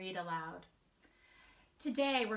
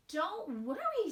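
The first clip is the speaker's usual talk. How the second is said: slow, higher, soothing; mother reassuring; 8 semitones higher, 3 dB louder than usual. Acted. Mother reassuring Wemberly, slow and higher. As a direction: soothe her like a mom. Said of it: "said her mother".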